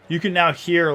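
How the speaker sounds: usual speaking voice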